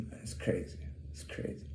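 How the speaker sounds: breathy whisper of disbelief. Real, not acted. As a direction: drop to a whisper, fed up. Trailing off, fed up.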